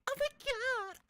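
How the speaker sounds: high breathy squeal of delight; swooning squeal. Retold or imitated. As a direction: squeal like a flattered date. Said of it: "they're like".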